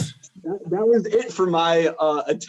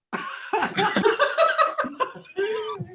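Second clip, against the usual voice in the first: high laugh. Real, not acted. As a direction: burst out laughing. Big laugh at joke.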